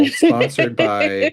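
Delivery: very slow sponsor read; Sethos as show host. Pretend. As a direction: stretch out the sponsor credit like a host. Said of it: "Sethos or someone being like".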